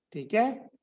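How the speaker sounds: ordinary delivery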